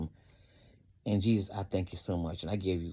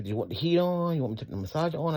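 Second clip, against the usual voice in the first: higher-pitched imitation of the wife asking; wife's offer; 8 semitones higher, faster than usual. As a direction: lightly imitate a solicitous wife, voice lifted. Voices wife's offer of help.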